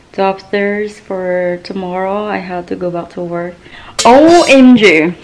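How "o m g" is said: sudden loud "oh my god" of dismay; surprised. Real, not acted. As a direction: blurt it out, caught off guard. Suddenly remembers forgotten camera.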